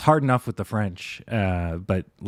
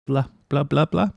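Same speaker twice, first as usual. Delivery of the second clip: mock French gibberish; 6 semitones higher than usual. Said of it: "I can't do it".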